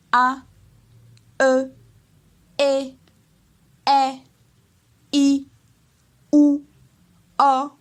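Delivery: each vowel "A, E, E, I, O, O" held high and loud. Shown as a demonstration. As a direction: pitch every isolated vowel up high and level. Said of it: "Ça, c'est le ton O".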